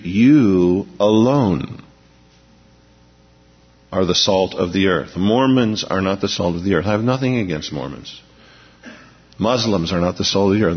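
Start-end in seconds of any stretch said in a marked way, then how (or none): 0.0-5.2 You alone drawn out very slowly with weight on You; preacher stressing You alone for the congregation; emphatic. Shown as a demonstration. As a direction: stretch You alone, pause, then are the salt of the earth. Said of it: "It's emphatic in the Greek".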